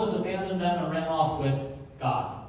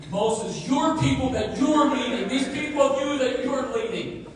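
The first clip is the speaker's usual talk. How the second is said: "your people" and "you're leading" punched higher and louder; stress on your; 6 semitones higher, 5 dB louder than usual. Shown as a demonstration. Preacher shows how God pushed the people onto Moses, stressing "your". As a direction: lean hard on "your" to disown them. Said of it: "I don't know if you noticed".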